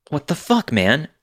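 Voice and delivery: high disbelieving cry; stunned outburst, voice jumping up; shocked. Retold or imitated. Recognising the driver, shocked.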